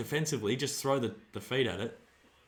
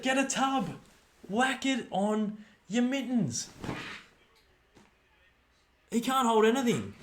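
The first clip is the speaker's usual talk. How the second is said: loud, high shouts at the fumbles; frustrated yelling; 9 semitones higher, 6 dB louder, slower than usual. Real, not acted. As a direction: yell Grippo at the players like they can hear. Fan yelling at fumbling players.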